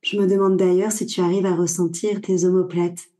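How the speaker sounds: calm Yoga Nidra voice with long pauses; guided meditation. Acted. Guide leading a listener through body awareness before sleep, guided meditation. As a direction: guide the listener inward in short, unhurried phrases.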